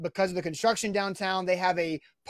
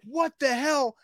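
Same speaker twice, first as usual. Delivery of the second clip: high-pitched mock outrage; retold indignant disbelief; 8 semitones higher, slower than usual. Soaked man to the window washer, indignant disbelief. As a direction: replay baffled protest at the culprit. Retelling his reaction to the washer.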